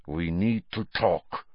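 put-on slow, abrupt accented demand; Karkaroff, abrupt. A narrator's reading. Karkaroff to Snape at his desk, abrupt. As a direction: press the point slowly and abruptly. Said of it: "said Karkaroff abruptly".

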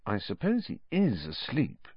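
softly doubtful; hushed musing; quiet. A narrator's reading. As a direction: keep your voice down, uncertain. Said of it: "said Ron quietly".

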